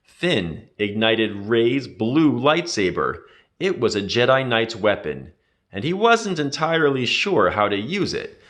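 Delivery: usual speaking voice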